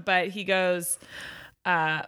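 ordinary talk

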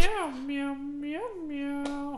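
high meowing cat. Acted. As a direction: meow like a cat. The other actor plays the cat.

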